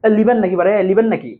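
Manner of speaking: put-on a light Rajshahi accent, লিবেন said with an l in place of the n of নিবেন; lightly voiced Rajshahi local offering something, লিবেন with an l sound; Rajshahi dialect. Teacher imitating a Rajshahi local offering something, light Rajshahi accent. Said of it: "রাজশাহীর মানুষ বলবে".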